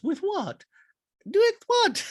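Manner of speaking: mock-baffled squeal; Dorit impression voice. Voicing Dorit in falsetto.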